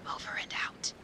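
whispered sign-off, breathy and hushed; whispering. Acted. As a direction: whisper it so sleeping skunks stay asleep. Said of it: "There are sleeping skunks in this area".

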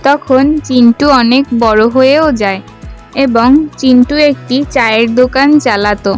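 ordinary delivery, nothing marked

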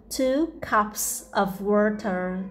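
very slow, drawn-out model sentence. Shown as a demonstration. Teacher drills the sentence slowly for children. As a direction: stretch every word for the learners. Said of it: "slow model reading for learners".

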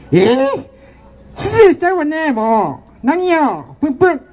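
high, huffy mock pout; huffy girly voice. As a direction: pout like an offended little girl. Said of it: "すごい声やな".

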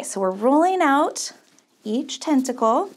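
usual speaking voice

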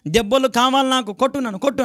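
high mock cry for a beating; fool begging for blows. Preacher mimics the fool who shouts for blows, high mock yell. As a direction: play the fool asking to be hit, pitched high. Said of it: "అంటే కేకలేస్తూ ఉంటాడు".

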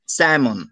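স্যামন with the L left silent. Shown as a demonstration. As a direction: say স্যামন as SAM-un, no L sound. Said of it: "উচ্চারণটা কি হবে".

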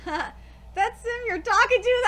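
ordinary talk, nothing marked

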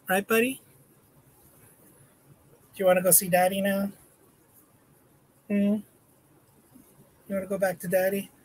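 put-on high, singsong baby talk to a dog; cutesy pet-owner voice teasing the dog. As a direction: coo at the puppy like a doting owner.

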